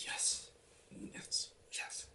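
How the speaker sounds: hushed, thrilled yes whispered; excited whisper. Retold or imitated. Thanking nan in secret, an excited whisper. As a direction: whisper your joy under your breath.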